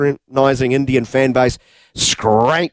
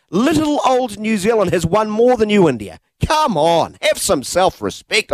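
taunting jab; 7 semitones higher than usual. Acted. Taunting India directly.

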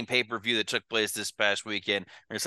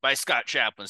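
upbeat show-host announcer; announcer intro; 4 semitones higher than usual. Acted. Host opens the podcast.

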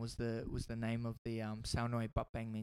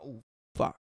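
mock dread; 3 semitones lower, 3 dB louder than usual. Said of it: "I was like".

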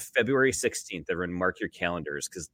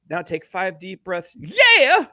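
hype-man shout closing a calm line; 11 semitones higher, 7 dB louder, slower than usual. Acted.

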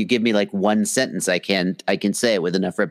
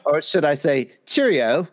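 mock English accent; 5 semitones higher than usual. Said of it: "an English accent".

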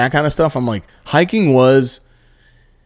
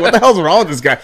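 laughing disbelief; 6 semitones higher, faster than usual. Retold or imitated. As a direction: crack up while asking. Retelling own baffled reaction.

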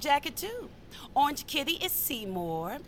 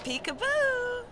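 high, slow sing-song peekaboo; playful peekaboo; 12 semitones higher, slower than usual. Acted. Cat lady pops out behind her map with a high sing-song peekaboo. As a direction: tease like a game with a toddler.

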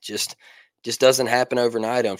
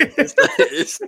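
laughing; 4 dB louder than usual. Real, not acted. Laughing squeak.

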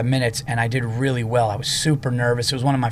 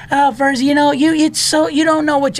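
high, loud mock protest; Bill Burr protesting; 13 semitones higher, 4 dB louder than usual. Comic imitates friend dismissing his Patriots praise, high-pitched protest. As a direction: squawk it like a comic refusing a compliment.

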